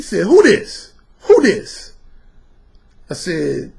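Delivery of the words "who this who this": louder, higher, confronting the caller; husband taking the phone, challenging the caller; demanding husband. Retold or imitated. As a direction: bark at the stranger on the phone.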